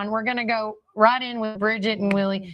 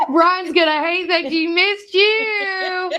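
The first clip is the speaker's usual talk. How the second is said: excited; 9 semitones higher, 4 dB louder, slower than usual. Real, not acted. Greeting surprise guest.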